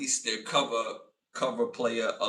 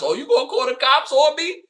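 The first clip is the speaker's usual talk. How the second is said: high, louder mock voice of the woman; 13 semitones higher, 9 dB louder than usual. Imitating the woman who rammed his car, mocking. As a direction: squeak out her taunt. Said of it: "I'm like".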